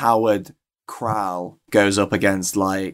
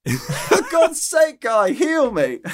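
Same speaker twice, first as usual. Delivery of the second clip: pleading character voice; 13 semitones higher, slower than usual. Acted. Gaius voiced pleading mid-song.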